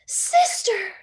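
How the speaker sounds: high loud cry for help; frightened shout. Acted. Baby calling her sister in a panic, high and loud. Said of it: "shouted Baby".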